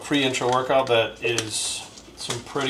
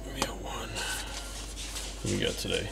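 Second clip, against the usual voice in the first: hushed, softer whisper to the dog; 13 dB quieter, slower than usual. Real, not acted. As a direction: lean in and whisper to the pet.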